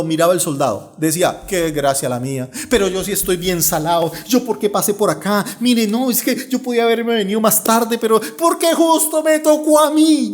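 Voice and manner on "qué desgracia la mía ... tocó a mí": angry grumbling. Acted. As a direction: build from a grumble to an exasperated outburst. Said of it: "con la rabia".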